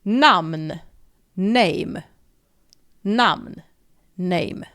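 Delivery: Name with ay, namn with an open a and a hummed n; alternating namn and Name. Shown as a demonstration. Teacher drilling the Swedish and English pair. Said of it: "we pronounce it namn".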